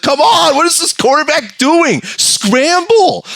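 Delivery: high, loud urging; frantic fan. Retold or imitated. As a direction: shout at the quarterback. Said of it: "He's like".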